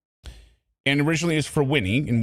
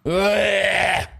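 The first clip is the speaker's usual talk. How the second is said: disgusted groan; 6 semitones higher than usual. Real, not acted. Disgusted reaction to the clip.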